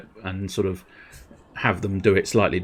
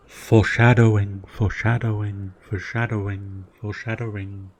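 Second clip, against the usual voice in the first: fading echo. Pretend. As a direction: repeat it like an echo dying away.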